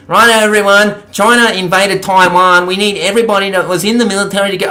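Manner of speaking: mock call to arms from a leader; Anthony Albanese. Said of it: "imagine if Anthony Albanese said".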